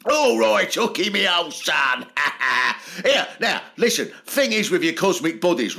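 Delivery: cockney geezer explaining physics to a mate; cockney Newton. Retold or imitated. Newton greeting Hooke at the Royal Society, cockney banter.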